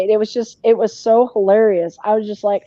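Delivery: normal talking voice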